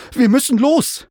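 high, loud, pressing shout; urgent call. A narrator's reading. Narrator urges his mount to the rescue, urgent call. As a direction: call out urgently, pitched right up. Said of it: "rief ich".

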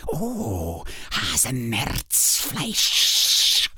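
slow, savoring hiss; hissing spider. A narrator's reading. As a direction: drag the word out like a hungry spider. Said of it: "zischte sie".

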